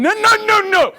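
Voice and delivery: high-pitched frantic noes; mock alarm, voice shooting way up; acted panicked cries. Dad in the car window trying to stop it, panicky. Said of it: "I'm in the window going".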